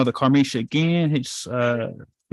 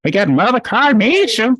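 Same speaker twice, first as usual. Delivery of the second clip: put-on country accent; 9 semitones higher, 4 dB louder than usual. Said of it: "That's my little country accent".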